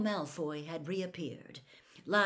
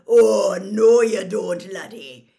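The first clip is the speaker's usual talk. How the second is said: loud, drawn-out warning roar; 15 dB louder, slower than usual. A narrator's reading. Moody catches Malfoy attacking, loud roar. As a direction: bellow it to stop someone in their tracks.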